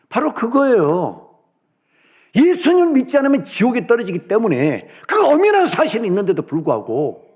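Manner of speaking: high-pitched, emphatic sermon climax; impassioned preaching. Real, not acted. Preacher giving the reason for evangelism, impassioned. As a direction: proclaim it urgently from the pulpit, high and forceful.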